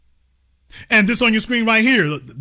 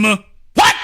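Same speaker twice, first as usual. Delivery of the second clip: mock shock; 6 dB louder than usual. Mock disbelief.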